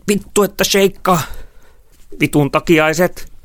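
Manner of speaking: high, loud shouted complaints; agitated cursing. A narrator's reading. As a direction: yell curses while fleeing over rough ground. Cursing on rough ground in flight. (kiihtynyttä kiroilua)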